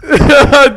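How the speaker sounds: high, loud crying laugh; despairing laugh. Real, not acted. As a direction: laugh through defeat. Laughing at the loss.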